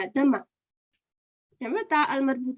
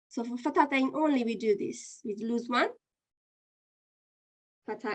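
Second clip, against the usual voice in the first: ordinary delivery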